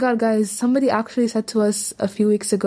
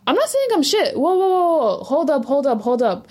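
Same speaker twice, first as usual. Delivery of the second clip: indignant protest; 5 semitones higher than usual. Real, not acted. Protests being called bad at Somali.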